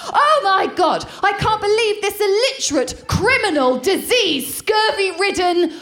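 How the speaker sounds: high, escalating hammed-up tirade; mock-outraged friend. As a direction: build from scandalised disbelief to a ranting climax. Friend's mock outrage builds to rant.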